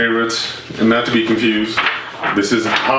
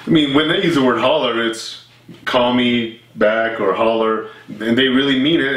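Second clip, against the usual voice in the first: ordinary talk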